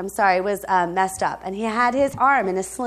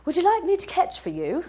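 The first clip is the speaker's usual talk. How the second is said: lightly imitated British accent, pitched higher; polite offer in a mock British accent; 4 semitones higher, 4 dB quieter than usual. British passenger offering the pastor help, imitated British accent. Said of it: "this British guy comes up and he goes".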